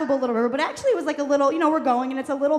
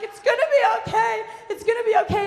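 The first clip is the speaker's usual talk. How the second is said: high, loud frantic reassurance; screaming reassurance; 9 semitones higher, 4 dB louder than usual. Retold or imitated. Daughter on the boulder to mom, high screamed reassurance. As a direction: act out yelling comfort over rushing water. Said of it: "I'm just screaming".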